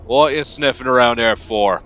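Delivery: loud, suspicious foreman; gruff challenge. Acted. Frowning dock boss confronts a stranger sniffing around, gruff and loud. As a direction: bark it at an intruder.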